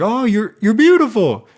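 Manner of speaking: mock gushing admirer, high and loud. Smitten guy compliments the princess, gushing. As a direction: blurt it out like an overwhelmed suitor. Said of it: "guys can't express their emotions".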